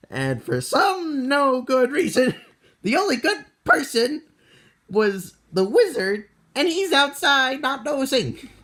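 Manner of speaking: high, laughing exasperation; exasperated. Real, not acted. Exasperated at the absent wizard.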